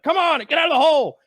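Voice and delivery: imitating a soldier shouting orders; urgent call. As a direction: yell them out of the hole, mock urgent. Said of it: "He's like".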